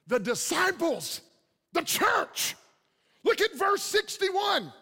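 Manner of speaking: high, ringing pulpit cry; impassioned preaching. Real, not acted. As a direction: proclaim the verse at full force, pitched high. Preaches at full force.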